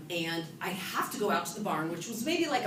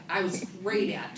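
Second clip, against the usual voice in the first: ordinary talk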